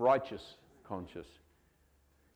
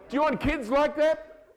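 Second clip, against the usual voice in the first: ordinary talk, nothing marked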